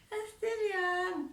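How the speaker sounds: put-on high, slow, giggly "I'm still young"; a shy teen dodging the question; coy girlish excuse. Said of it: "how I used to respond to boys".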